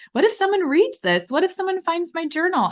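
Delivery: high-pitched fretful inner voice; mock worried. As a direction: imitate your younger self fretting. Voicing her younger self's worried thoughts.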